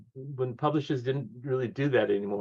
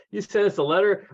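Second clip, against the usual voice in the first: higher, louder disbelieving retort; incredulous scoff; 8 semitones higher, 5 dB louder than usual. Retold or imitated. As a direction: throw it back as if absurd. Publisher cuts him off.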